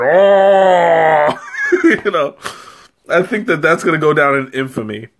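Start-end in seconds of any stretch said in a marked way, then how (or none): 0.0-1.5 sudden loud laughing "Oh!"; shouted catchphrase with a laugh; calling-card shout. Pretend. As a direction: blurt it out loud as a signature yelp. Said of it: "this is my calling card".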